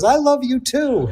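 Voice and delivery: high, sing-song imitation of mom; mother's sweet reply. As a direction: play the doting old mother, lilting. Mother answers daughter lovingly.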